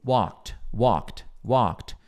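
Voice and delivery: walked said softly, ending in a crisp T sound; pronunciation demo. Shown as a demonstration. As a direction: softly repeat walked, snap the final T. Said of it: "E aqui é T".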